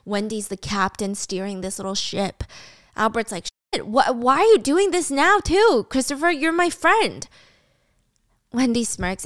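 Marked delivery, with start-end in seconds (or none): 3.7-7.3 higher, stammering dismay; dismayed, pitched-up objection; hurt protest. Acted. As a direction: plead with a friend who has turned on you. Betrayed friend protests the accusation.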